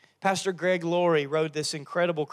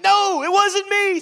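much higher, louder, slow Peter denial; panicked denial; 8 dB louder than usual. Acted. Peter denying Jesus again, high panicked squeak. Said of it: "he's like".

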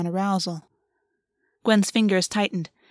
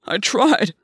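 pleading, raised-voice insistence; desperate protest; 4 dB louder than usual. Acted. Gwen repeats his desperate protest.

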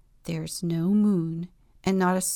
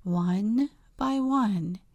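usual speaking voice

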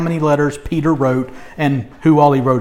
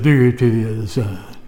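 mocking; 5 semitones lower than usual. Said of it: "He's, like, mocking her".

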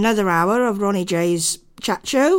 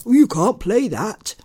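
lightly put-on mocking voice; a hint of a prissy censor; mock Facebook voice; 2 semitones higher, 3 dB louder than usual. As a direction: imitate the killjoy rule-maker. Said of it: "they still turn around and go".

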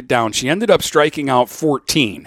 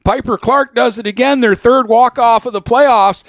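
loud, high, triumphant announcer call; excited game call; 9 semitones higher, 3 dB louder than usual. Retold or imitated. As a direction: shout the walk-off win like a radio announcer. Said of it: "they were listening to me 'cause I'm like".

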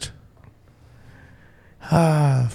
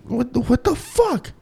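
bewildered; 9 semitones higher, 5 dB quieter than usual. Retold or imitated. Retold reaction to strange laugh.

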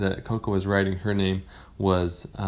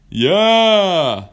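high, loud, long-held reply; big whale voice; 10 semitones higher, 10 dB louder than usual. Acted. Whale answering the pirates, loud drawn-out call. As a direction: call it out, stretched wide.

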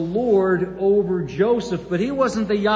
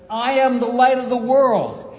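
loud proclamation; 5 semitones higher, 6 dB louder than usual. Acted. Jesus's claim proclaimed.

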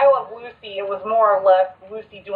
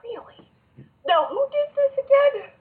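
incredulous. Real, not acted. Outraged at the ranking.

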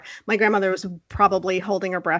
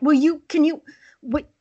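flustered plea; 5 semitones higher than usual. Retold or imitated. Acts harried woman with jumping child.

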